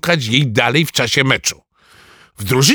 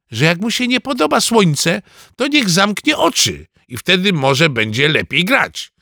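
put-on higher-pitched boyish comeback; cheeky retort; 7 semitones higher than usual. A narrator's reading. Boy answering the captain's complaint about the sun, cheeky retort.

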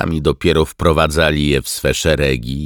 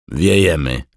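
through clenched teeth; 4 semitones higher, 7 dB louder than usual. A narrator's reading. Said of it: "zacisnął zęby Marek".

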